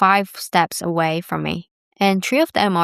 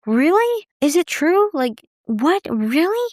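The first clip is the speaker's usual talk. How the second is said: amazed disbelief; 10 semitones higher than usual. Retold or imitated. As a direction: squeal of surprise at her age. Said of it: "they were saying that in Japanese".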